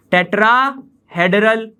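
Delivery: Tetrahedral, said slowly in two separated parts; slow dictation. Shown as a demonstration. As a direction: draw out tetrahedral with a break in the middle. Teacher repeats term for students. (Tetrahedral को धीरे, तोड़कर बोलें)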